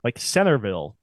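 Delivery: "Centerville" with no T, as "Sennerville"; dropped T. Shown as a demonstration. Showing own Utah dialect, T left out. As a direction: leave the T out of "Centerville". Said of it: "leave out, like, the, the T".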